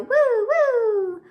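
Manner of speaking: high, loud playful woo woo. Acted. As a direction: whoop like a spinning yo-yo. Yo-yo noises in the rhyme.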